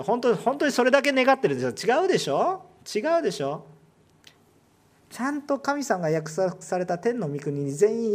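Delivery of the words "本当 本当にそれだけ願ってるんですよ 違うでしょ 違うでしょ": fervent challenge. Acted. Preacher challenging congregation.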